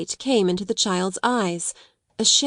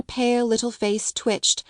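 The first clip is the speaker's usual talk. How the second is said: usual speaking voice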